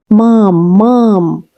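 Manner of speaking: put-on small boy calling out loud for his mother; a young child calling out to his mother; child crying out. A narrator's reading. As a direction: cry out for mom, hopeful and high. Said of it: "little Sergei had once cried".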